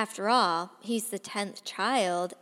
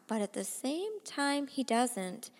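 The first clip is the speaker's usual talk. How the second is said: plain reading voice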